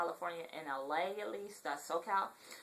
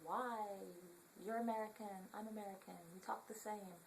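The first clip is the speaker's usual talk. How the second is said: soft, higher-pitched retort; acted-out reply; 3 semitones higher, 10 dB quieter than usual. Vlogger answering shoppers about her accent, softly acted. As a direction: replay your own reply in a small, raised voice. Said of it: "and I'm like".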